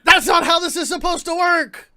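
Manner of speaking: shouting. Acted. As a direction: yell at them to just take the money. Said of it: "Why are you shouting?".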